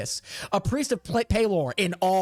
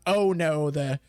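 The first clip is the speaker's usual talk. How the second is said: normal talking voice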